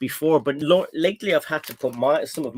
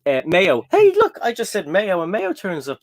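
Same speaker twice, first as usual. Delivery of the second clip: normal talking voice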